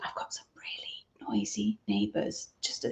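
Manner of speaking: hushed aside, barely voiced; confidential whisper. Real, not acted. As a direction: whisper it so the neighbours won't hear. Confiding about the noisy neighbours next door.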